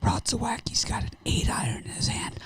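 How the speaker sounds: whispered mock golf commentary; hushed, conspiratorial tournament whisper; golf announcer whisper. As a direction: whisper it like a course-side commentator.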